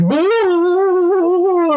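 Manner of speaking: long high-pitched mock scream; Hank. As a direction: hold one high cartoon wail. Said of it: "Hank's just, like, screaming".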